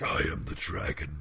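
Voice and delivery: very low, hushed dragon voice; imitated dragon voice. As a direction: imitate a game master's deep dragon. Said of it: "he's talking like that".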